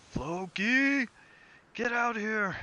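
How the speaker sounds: high, loud summoning shout; calling out. Acted. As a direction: yell down the hallway for someone. Captain calls cook from hallway.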